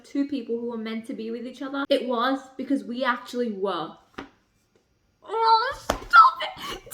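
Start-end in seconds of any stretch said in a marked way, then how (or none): 5.3-6.9 high, drawn-out squeal of delight; squealing protest. Real, not acted. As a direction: gush at the book, stretching every word out. Reacting to book romance.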